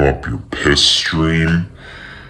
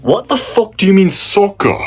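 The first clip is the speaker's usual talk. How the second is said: angry Brit; 7 semitones higher than usual. Acted. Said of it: "Somebody overhears you".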